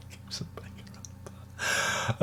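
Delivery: cheeky whispered aside. Acted. Repeating the old pecker line to viewers, whispered. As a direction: breathe it out under your breath, naughty.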